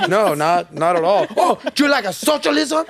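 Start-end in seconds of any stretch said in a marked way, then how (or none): 1.4-2.9 higher, mocking voice of a pushy dad; a hint of a grilling dad; mock dad interrogation. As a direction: lightly put on the nosy father. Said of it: "I'm like".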